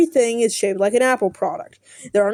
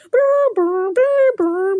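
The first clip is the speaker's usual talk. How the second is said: high brr sound effect, much higher; buzzing noise; 9 semitones higher, much slower than usual. Retold or imitated. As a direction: buzz like a cartoon alarm. Imitating show sound effects.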